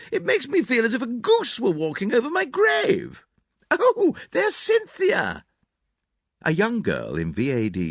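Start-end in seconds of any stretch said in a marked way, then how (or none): 0.1-5.4 put-on high, fluttery elderly lady; gushing hostess, a little theatrical; Mrs Inglethorpe. A narrator's reading.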